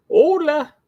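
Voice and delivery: shrieked high hola; squeaky hola. Acted. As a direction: pop out a squeaky hello. Squeaky greeting.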